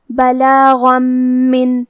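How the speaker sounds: بلاغا من with the م doubled and nasal hum; ghunna and shadda. Shown as a demonstration. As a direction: hold مّن with a nasal ghunna. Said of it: "غنہ اور تشدید کے ساتھ ادا کریں گے". (من پر غنہ اور تشدید)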